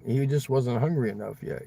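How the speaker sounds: normal talking voice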